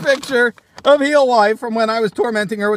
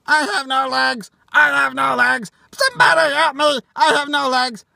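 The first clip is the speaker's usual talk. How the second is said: higher, pleading toy voice calling for help; a broken action figure crying out, somebody help me; 5 semitones higher than usual. Pretend. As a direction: play the legless toy begging to be saved.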